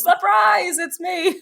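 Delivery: high, playful cry of surprise, breaking into a laugh; imitating a surprise reveal. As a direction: chirp it like an old lady jumping out. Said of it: "then she goes".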